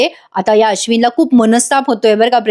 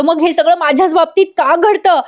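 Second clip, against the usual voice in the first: retold shout; 6 semitones higher than usual. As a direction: imitate a wronged man crying out. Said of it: "Ashwin ओरडून म्हणतो". (नाटकी, चढ्या सुरात तक्रारीचे ओरडणे)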